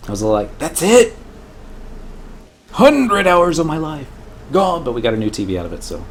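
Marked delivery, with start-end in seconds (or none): 0.6-4.8 higher, louder, slow disbelief; indignant outburst. Retold or imitated. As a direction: cry out in outrage. Retold outburst at game ending.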